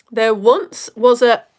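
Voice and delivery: slow, loud, bouncy limerick start; limerick reading. Shown as a demonstration. Host starting to read Edmund's poem aloud, slow and loud. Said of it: "so it's a bit Limerick-esque".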